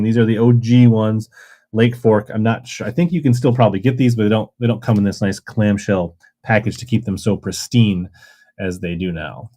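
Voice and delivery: normal talking voice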